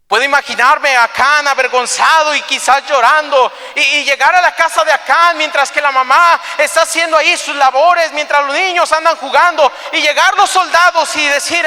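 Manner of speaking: high, loud, pressing storytelling; impassioned preaching. Acted. Preacher picturing soldiers coming to Achan's house, impassioned. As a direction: preach the scene with mounting force.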